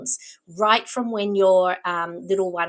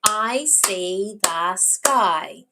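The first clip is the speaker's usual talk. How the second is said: very slow, one word per beat; clapped word by word; much slower than usual. Shown as a demonstration. Teacher claps words with child, very slow and even. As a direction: stretch and separate each word as if clapping it. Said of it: "Let's clap those words".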